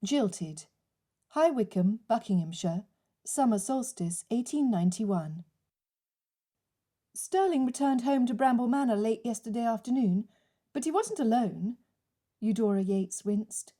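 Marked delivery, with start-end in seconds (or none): none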